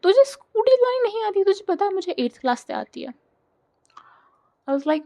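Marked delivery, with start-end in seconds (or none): 0.0-3.1 high, fast mocking taunt; friend shaming her. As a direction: tease her like a smug friend. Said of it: "B मुझे बहुत शेम करती थी". (चिढ़ाती, ताना मारती दोस्त)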